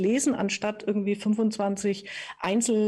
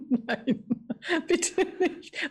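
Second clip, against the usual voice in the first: laughing; 8 semitones higher, much slower than usual. Real, not acted. Laughing at the 30-page PDF.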